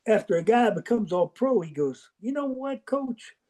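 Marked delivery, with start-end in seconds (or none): 2.2-3.4 lightly voiced scout, higher and softer; a hint of a sheepish scout; mock scout voice. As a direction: imitate the scout conceding. NFL scout retold, he goes.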